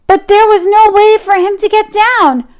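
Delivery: ordinary delivery, nothing marked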